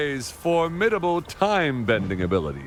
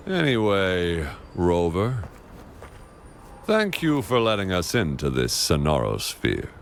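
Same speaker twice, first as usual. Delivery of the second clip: ordinary talk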